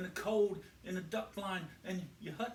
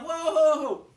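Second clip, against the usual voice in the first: loud high whoa to stop a car; farmer shouting whoa; 10 semitones higher, 14 dB louder than usual. Retold or imitated. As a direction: bellow at him to halt. Farmer stops the driver.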